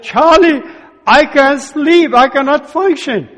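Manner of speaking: begging a friend for help, voice up and strained; desperate plea. Retold or imitated. Desperate call, can't sleep.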